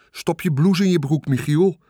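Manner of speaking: mother calling out to her son at the fair. A narrator's reading. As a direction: call across to the boy. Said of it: "riep mevrouw Hartsuiker".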